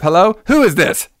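high-pitched, abrupt questioning; acted-out demand to know who's there; demanding phone greeting. Sergeant demands who picked up.

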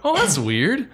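high, loud mock-baffled reaction; acted puzzled objection. Host replays reaction to practical set in prequels, high mock bafflement. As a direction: voice a past thought with exaggerated disbelief. Acting out own past reaction.

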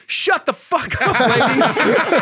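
high, very loud drawn-out shout; balcony heckler's yell. Retold or imitated. Guy in the balcony to a lady below, imitated loud yell. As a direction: bellow it across a theater. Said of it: "up in the balcony, a guy goes".

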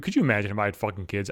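normal talking voice

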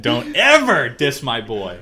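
slow, loud warning with a big punch on "ever"; mock outrage. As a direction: defend your favourite with playful indignation. Mock defence of favourite character.

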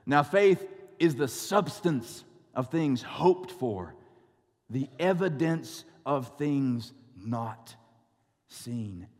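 slow, measured scripture reading. Acted. Pastor reads Hebrews slowly to the congregation, measured. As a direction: read the verse slowly to the congregation. King James verse read.

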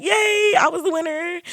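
high, lightly cheering voice; mock celebration. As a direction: act out a naive little "yay". Acting out her naive celebration.

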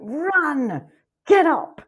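high, loud urging yell; desperate shouted commands; screaming. Retold or imitated. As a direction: re-enact screaming at the screen. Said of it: "I was screaming".

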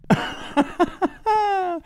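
high-pitched burst of laughter. Real, not acted. Laughs at himself.

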